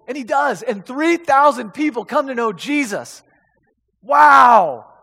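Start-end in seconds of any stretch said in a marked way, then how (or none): 4.0-4.9 loud drawn-out wow. Real, not acted. Preacher marveling at three thousand converts, loud. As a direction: let the wow ring out in awe.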